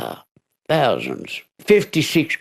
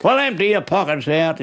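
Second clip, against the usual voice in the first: higher, commanding order; a hint of a stern homeowner; lightly voiced homeowner; 11 semitones higher than usual. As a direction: homeowner orders burglar to empty pockets.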